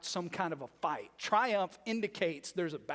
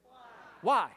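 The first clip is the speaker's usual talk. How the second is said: loud answer from the crowd; call and response; 7 dB louder than usual. Shown as a demonstration. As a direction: shout the word back together. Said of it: "Everybody say that word".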